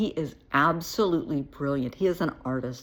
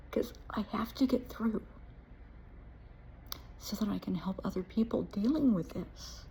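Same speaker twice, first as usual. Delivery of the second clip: choked, breathy near-whisper holding back tears; emotional; 5 semitones higher, 6 dB quieter than usual. Real, not acted. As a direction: voice fading into a whisper as emotion wins. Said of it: "I'm gonna get emotional here".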